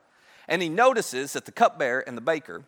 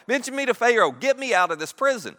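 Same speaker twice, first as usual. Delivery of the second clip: louder, higher acted pleading; urgent plea; 8 semitones higher, 4 dB louder than usual. Joseph begs the cupbearer to get him out, pleading. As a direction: push the request hard, begging to be freed. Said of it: "Joseph makes a plea".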